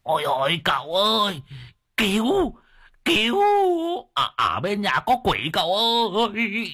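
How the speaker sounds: high, frantic wail for help; shrill panicked cry. A narrator's reading. Old Đồng shrieks to his master for help, high and frantic. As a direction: squeal it out like a terrified old servant. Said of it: "mồm gào lên choe choé".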